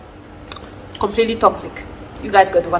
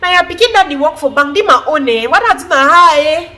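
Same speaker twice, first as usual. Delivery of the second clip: complaining mother; 8 semitones higher than usual. Retold or imitated. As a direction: rant like a mother who undervalues the kid. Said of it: "you hear some mother saying".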